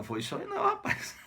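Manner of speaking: high-pitched, laughing comeback; amused retort. Retold or imitated. Iracema tells Martim it was no dream, laughing high. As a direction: squeak it out through a laugh.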